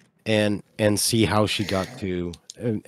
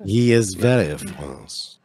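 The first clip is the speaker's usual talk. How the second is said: put-on French accent; 3 semitones lower, slower than usual. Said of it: "Is Nook French?".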